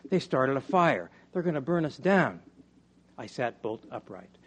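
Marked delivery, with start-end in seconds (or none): 0.1-2.4 put-on urgent, raised child's alarm. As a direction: blurt it out in alarm. Barty's frightened news at night.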